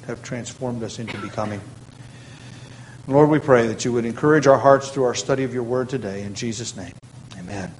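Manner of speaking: soft, earnest prayer voice. Acted. Pastor praying for the congregation before study, softly earnest. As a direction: pray humbly on behalf of the church.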